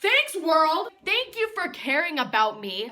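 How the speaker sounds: loud, bitterly mocking gratitude; sarcastic thanks. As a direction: shout thanks you clearly don't mean. Sarcastic thanks after being abandoned.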